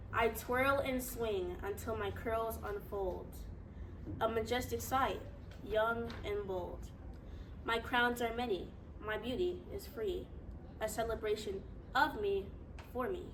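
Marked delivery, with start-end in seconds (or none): none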